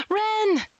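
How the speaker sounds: gasp, then a high-pitched cry; acted horror, crying out his name; shocked cry. Woman finds her boyfriend hurt, shocked cry. Character gasps, finds Ren.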